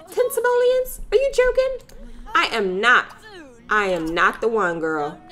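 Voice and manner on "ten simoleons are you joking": high, shrieking disbelief; high shriek at a ten simoleon muffin; outraged disbelief. Acted. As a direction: squeak it out, utterly scandalised.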